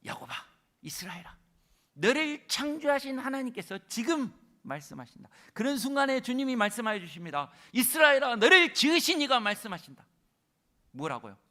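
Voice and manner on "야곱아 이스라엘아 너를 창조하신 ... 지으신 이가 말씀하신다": loud, high proclamation as God; God's voice proclaiming. Acted. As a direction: speak as God calling Israel by name. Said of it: "주님이 말씀하십니다". (하나님의 선포하는 목소리)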